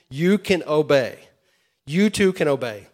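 loud, emphatic preaching; rising preacher fervor; emphatic declaration. Real, not acted. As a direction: proclaim it to the room.

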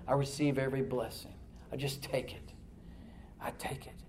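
soft, hushed prayer with long pauses; quiet prayer. Acted. Preacher modelling a thankful prayer to the Lord, quiet and hushed.

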